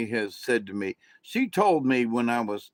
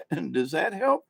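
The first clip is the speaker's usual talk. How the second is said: laughing; 5 semitones higher than usual. Real, not acted. Laughs after his own accent bit.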